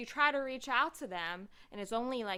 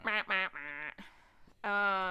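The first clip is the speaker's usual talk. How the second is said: mock sad trombone; 4 dB louder than usual.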